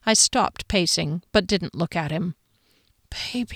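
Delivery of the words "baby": soft breathy whisper close in. A narrator's reading. Ex-boyfriend whispering into her neck to win her back. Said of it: "he whispered".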